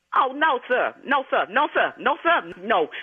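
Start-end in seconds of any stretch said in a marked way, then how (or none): none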